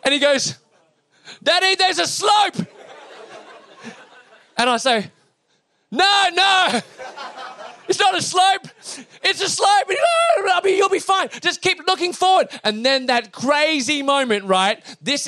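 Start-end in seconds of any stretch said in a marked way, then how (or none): 1.4-2.7 put-on high, slow child's cry of alarm; hammed-up little boy shouting in fright. Son spotting a little slope calls to dad, mock alarm. 5.9-12.5 high-pitched mock-panicked shouting; hammed-up dad trying to calm a child; frantic reassurance. Father talking his son past the slope, high frantic reassurance.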